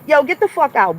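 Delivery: kicking a troll out of the stream, angry; angry order. Real, not acted. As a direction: throw him out of the chat, fed up.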